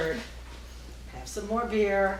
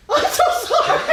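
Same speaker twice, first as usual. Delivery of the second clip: laughing apology; 6 semitones higher, 7 dB louder, faster than usual. Retold or imitated. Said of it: "I laid down and I said".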